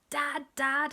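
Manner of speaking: lightly voiced baby chant; a hint of toddler babble; babbling baby. A narrator's reading. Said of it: "our funny baby was saying".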